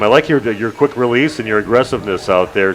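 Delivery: ordinary talk, nothing marked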